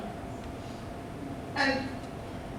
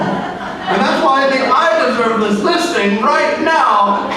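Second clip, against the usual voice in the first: slow, swelling rousing pitch rising to a shout; patriotic pitch; 3 dB louder than usual. Acted. Agent pitching Mister Customer over humming, building to a patriotic shout. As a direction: sell the listing like an anthem.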